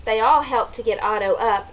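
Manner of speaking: usual speaking voice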